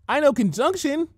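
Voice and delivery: high, bubbly and cheerful; bright Pinky voice. A narrator's reading. Pinky boasting to Rainbow Dash, high and bubbly. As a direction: chirp it out proudly. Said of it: "Pinky said brightly".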